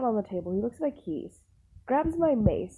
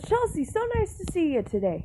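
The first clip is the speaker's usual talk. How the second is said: higher, bright put-on boss voice; cheerful boss; 9 semitones higher than usual. Owner cheerfully greeting the worker he came to hug. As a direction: beam at her like a friendly boss. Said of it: "He goes".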